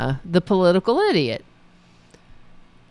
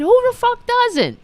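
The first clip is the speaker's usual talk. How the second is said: high, sharp disbelieving retort; incredulous outburst; 13 semitones higher than usual. Real, not acted. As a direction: fire back in disbelief. Outraged retort to the statement.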